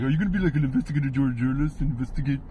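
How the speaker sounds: fast, airy dim model voice; quick clueless model quiz; Zoolander voice. Retold or imitated. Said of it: "He's like".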